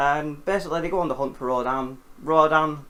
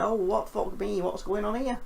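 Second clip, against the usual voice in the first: mock startled; 6 semitones higher than usual. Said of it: "they wake up like".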